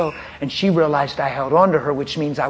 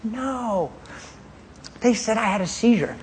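wife's dazed answer; 7 semitones higher than usual. Retold or imitated. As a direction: lightly voice her confusion. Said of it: "I said, "Don't you remember".

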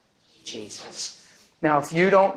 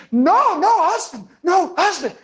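high, louder "no, no, husband"; flustered refusal; 11 semitones higher, 3 dB louder than usual. Retold or imitated. Older woman refuses the preacher's hug, flustered.

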